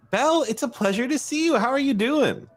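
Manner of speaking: high, fast and bubbly greeting; cheerful high girl voice. Acted. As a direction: chirp it out brightly like an eager schoolgirl. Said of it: "is like".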